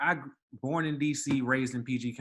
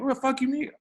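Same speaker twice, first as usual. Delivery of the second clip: much higher mock snap in her voice; her retort mocked; 13 semitones higher than usual. Drunk woman snapping at the concierge, mocked much higher. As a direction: fling the line back at him as she did. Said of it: "that really got".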